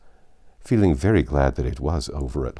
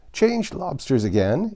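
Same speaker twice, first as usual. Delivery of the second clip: yelled; 11 semitones higher than usual. Acted. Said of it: "yelled the Gryphon at the top of its voice".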